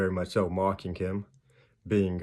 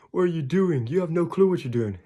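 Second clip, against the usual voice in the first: high-pitched mock outrage; indignant mock complaint; 8 semitones higher, 3 dB louder than usual. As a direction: imitate someone angrily questioning God. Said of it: "we get mad because God tells us no".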